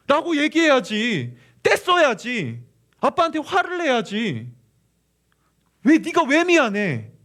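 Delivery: loud, high, drawn-out exhortation; angry father. Retold or imitated. Father urging his son to get mad at him, angry. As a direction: scold out of hurt love, stretching each ending. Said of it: "너무 화가 났대요".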